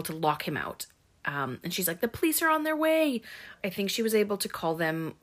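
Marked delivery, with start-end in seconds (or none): 2.0-3.2 high-pitched mock-panicked warning; imitated urgent warning. Wounded girl warning the attacker outside, high mock alarm. Retold girl warns the killer.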